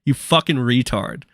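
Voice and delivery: normal talking voice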